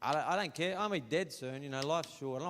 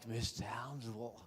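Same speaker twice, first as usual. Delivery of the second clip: ordinary delivery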